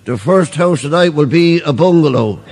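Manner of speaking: a hint of a bingo caller. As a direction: call it out like a bingo hall priest. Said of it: "the priest had called the numbers".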